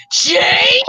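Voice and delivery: crying shout. Acted. Thomas discovering James, shouting his name. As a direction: yell the name, upset. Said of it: "He goes like".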